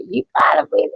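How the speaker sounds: high, sharp mock yell; hammed-up scolding mother; mock angry mother. Mother chasing her daughter, mock angry yell.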